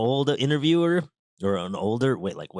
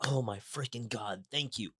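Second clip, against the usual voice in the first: grateful hush; 9 dB quieter than usual. Retold or imitated. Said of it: "I'm just like".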